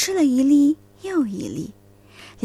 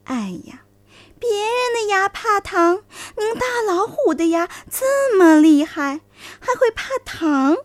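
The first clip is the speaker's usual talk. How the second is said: flattering fox voice; 7 semitones higher, 4 dB louder than usual. Acted.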